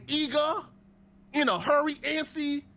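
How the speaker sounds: high-pitched, mocking list; mock antsy eagerness. Mocking a man waiting for her, antsy eagerness. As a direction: pitch each word up, mock impatient.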